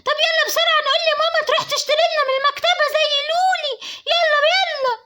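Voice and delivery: put-on high child's voice, fast, rising to a crying shout; urgent little girl. Little girl urging her sister to run to mom, high and frantic. Girls rush to ask mom.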